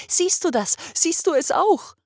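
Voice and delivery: high, loud, thrilled; almost shout with delight; excited calls. Acted. Father urging his wife to see, excitedly loud.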